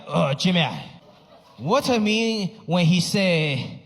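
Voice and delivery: slow, lightly voiced puzzled old father; Chinese dad accent. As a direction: ask like a confused Chinese dad. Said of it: "My dad was like".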